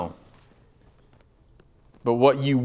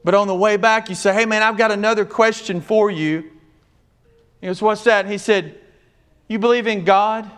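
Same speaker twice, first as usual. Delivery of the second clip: high, fervent preaching storyteller voice; preaching at full force; 8 semitones higher than usual. Acted. Preaching continues, quoted question.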